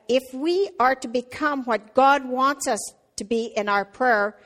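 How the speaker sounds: usual speaking voice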